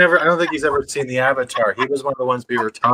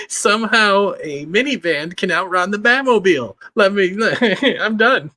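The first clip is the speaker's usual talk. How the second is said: higher, talking through real giggles; laughing; 6 semitones higher than usual. Real, not acted. Cracking up with the co-host about the show, laughing. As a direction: break up laughing while trying to talk.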